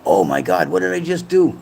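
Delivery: retold dismay. Streamer acts out his autocorrect blunder, mock dismay.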